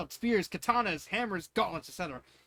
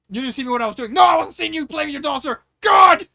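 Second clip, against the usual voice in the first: high, loud comic exchange; Spaceballs parody; 10 semitones higher, 18 dB louder than usual. Pretend. Lord Helmet caught with his dolls, loud comic bluster. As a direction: bluster then squeak. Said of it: "a Spaceballs scene".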